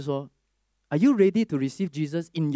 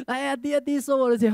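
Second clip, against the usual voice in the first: put-on pleading old grandpa; 9 semitones higher, 3 dB louder than usual. Old man pressing money on him.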